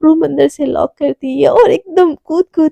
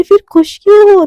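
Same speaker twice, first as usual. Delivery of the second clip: excited; 6 semitones higher, 6 dB louder than usual. Retold or imitated. Devika challenges Radhika.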